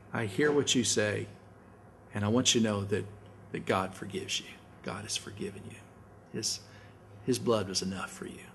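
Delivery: quiet, tender words of forgiveness; gentle reassurance. Shown as a demonstration. Pastor gently assures a friend who confessed, quietly tender.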